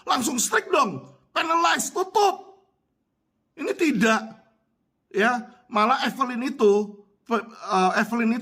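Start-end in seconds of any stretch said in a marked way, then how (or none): 0.1-4.5 high, emphatic insistence; exasperated demand. Real, not acted. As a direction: demand action word by word, pitched high. Demanding regulators act strictly. (tuntutan geram bernada tinggi)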